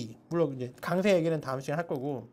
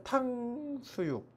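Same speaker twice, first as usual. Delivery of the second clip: 탕수육 slow, first syllable hit high; stress on the first syllable; 6 semitones higher, 8 dB quieter, much slower than usual. Shown as a demonstration. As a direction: lean on 탕 in 탕수육. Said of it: "아무 때나 막 강세를 넣을 수가 있어요". (탕수육 첫 음절에 강세)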